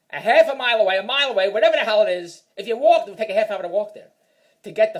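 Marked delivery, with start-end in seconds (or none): none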